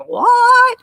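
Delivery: high, loud disbelieving yelp; shocked what. Retold or imitated. Conference crowd hearing the bar ran dry, loud shocked yelp. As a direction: shoot it up high in mock shock. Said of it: "everybody was like".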